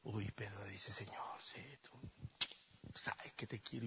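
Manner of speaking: soft, whispered and tender; hushed whisper. Acted. Peter answering Jesus in a hushed whisper. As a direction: barely breathe the words.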